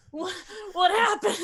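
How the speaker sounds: high, startled waking gasp; high-pitched, confused wake-up; startled awake. Acted. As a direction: stammer it like someone jolted from sleep. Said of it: "woken up by someone screaming".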